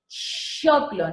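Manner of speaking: choclo with a stretched ch, slow and clear; demo word. Shown as a demonstration. Teacher demonstrates the ch in choclo to students. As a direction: draw out the ch in choclo. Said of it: "cuando dices che".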